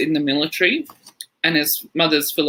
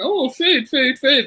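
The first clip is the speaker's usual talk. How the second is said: much higher, gleeful; delighted; 8 semitones higher than usual. Retold or imitated. Comedian opening the basket, imitating gleeful delight. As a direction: squeal with mock delight at the food.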